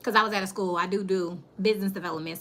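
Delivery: normal talking voice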